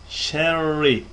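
usual speaking voice

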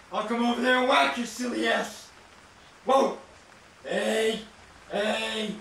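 ordinary delivery

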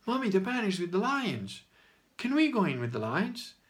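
put-on light childlike plea; little boy. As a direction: mimic a small boy pleading with his mom. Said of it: "one boy in the crowd goes".

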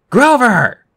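loud, high-pitched yell; shouting. A narrator's reading. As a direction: yell the name to break through to him. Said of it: "we both shouted".

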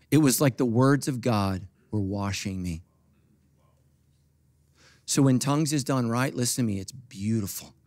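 ordinary talk, nothing marked

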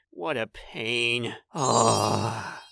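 low, slow, soft grumble; dejected sigh. Acted. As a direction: let it sag into a sigh. Dejected about arranged marriage, sighs.

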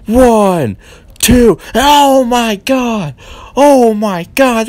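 announcer losing it at a kickout; excited pin count. Acted.

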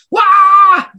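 loud high burst of a scream; startling scream. Shown as a demonstration. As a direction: scream out suddenly to startle the listener. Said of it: "did I scare you".

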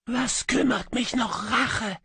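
high, ghostly, sighing echo voice; a lost spirit murmuring its lament from a kettle; high wistful whispery phantom; faint melancholy echo. A narrator's reading.

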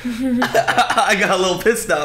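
high, slow laughing line. Retold or imitated. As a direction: giggle through the words.

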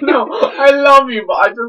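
laughing, high and loud; fond, giggly frustration; loving exasperation. Real, not acted. Affectionate frustration at friend, laughing.

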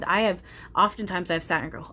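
ordinary talk, nothing marked

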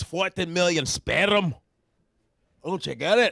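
slow Scottish punchline, rolled R; Scottish accent. Acted. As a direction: deliver the punchline in Scottish brogue. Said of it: "this Scottish dude was telling me a joke".

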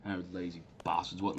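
ordinary delivery

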